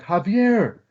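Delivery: high called-out yell to Javier; mock scream. Calling brother from the door during dogfight, yelling. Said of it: "I scream".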